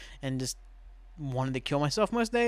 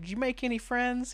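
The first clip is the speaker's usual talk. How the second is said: lightly voiced mom, pitched up high; sketch mom; 10 semitones higher than usual. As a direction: ask in a high voice as a mom picking up her kid.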